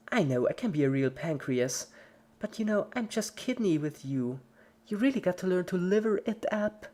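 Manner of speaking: high, punchy comic patter; creepy cheerful Pinkie. A narrator's reading. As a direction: deliver puns like a comedian. Pinkie's organ puns.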